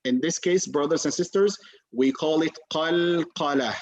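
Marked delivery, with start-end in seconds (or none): none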